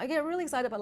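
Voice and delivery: excited, short of a shout; loud, excited. Shown as a demonstration. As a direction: lift volume and pitch to grab attention. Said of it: "using the volume of my voice to attract attention".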